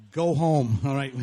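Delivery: put-on loud, blunt Maine accent; loud Maine-accented dismissal; Maine humor voice. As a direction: send them off like a gruff Maine local. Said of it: "That's my little Maine humor thing".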